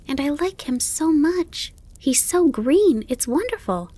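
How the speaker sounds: put-on high, gushing child voice; excited. A narrator's reading. Fawn telling his mother about the grasshopper, excited. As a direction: rave about a new friend, high-pitched. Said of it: "Bambi was very excited about his conversation".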